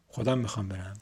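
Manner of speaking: usual speaking voice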